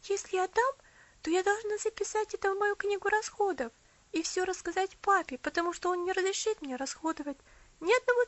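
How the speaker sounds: put-on high, earnest girl; young girl voice. A narrator's reading. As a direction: reason with him gently. Girl Elsie reasoning.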